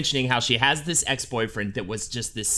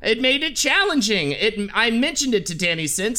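higher, lightly nasal-free voice of a fussy actor; imitating a famous actor's voice, higher; Wallace Shawn impression; 8 semitones higher than usual. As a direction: read it as the actor himself, higher. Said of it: "I'll do a quote in his own voice".

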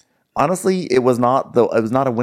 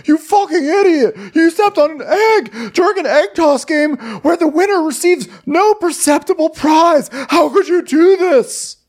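very high, loud mock outrage; crying little-boy tantrum; 4 dB louder than usual. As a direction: wail the complaint like a child losing a game. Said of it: "literally crying like a little boy".

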